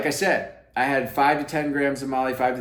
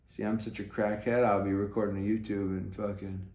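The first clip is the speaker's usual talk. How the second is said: muttered aside; 6 semitones lower, 9 dB quieter than usual. Real, not acted. Picks something off his leg.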